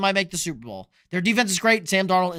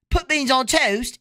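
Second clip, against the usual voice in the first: high, drawn-out British parody; slow mock-British call, pitched up; mock British accent; 7 semitones higher, 4 dB louder, slower than usual. Streamer teasing about beans on toast, mock British accent.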